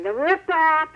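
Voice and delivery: ordinary talk, nothing marked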